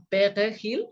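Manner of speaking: perejil with a French throaty R; mock French pronunciation of Spanish; French accent. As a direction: imitate a French speaker failing at perejil. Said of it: "Y entonces ellos decían".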